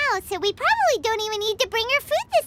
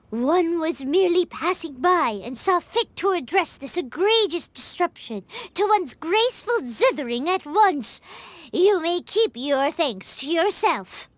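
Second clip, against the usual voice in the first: lower, unhurried voice of a proud immortal adeptus; Cloud Retainer impression; 5 semitones lower than usual. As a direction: play the lofty adeptus looking down on a mortal, pitched low. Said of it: "a very good impression of Cloud Retainer".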